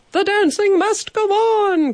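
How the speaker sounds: the upset manager bursting in, crying out; cried out. A narrator's reading. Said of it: "cried the hotel manager".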